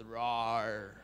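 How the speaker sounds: "roar" low and drawn out, R at both ends; a word-like "roar" with a held final R; mock English roar. Shown as a demonstration. Teacher mocking a lion that says "roar", low mock roar. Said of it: "walk up to a lion and he goes".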